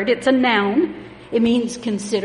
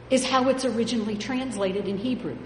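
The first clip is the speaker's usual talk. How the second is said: ordinary talk, nothing marked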